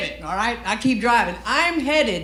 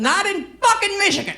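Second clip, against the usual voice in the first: high-pitched comic indignation; exasperated outburst; 10 semitones higher than usual. Acted. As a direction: burst out high in mock disbelief. Comic outburst contrasting home with Michigan.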